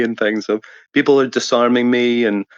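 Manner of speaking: ordinary talk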